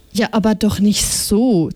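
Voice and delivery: ordinary delivery, nothing marked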